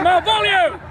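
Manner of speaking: high, loud reprimand; indignant rebuke. Acted. Lady rebuking her flirting servant, high loud indignation. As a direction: call the name sharply in outrage.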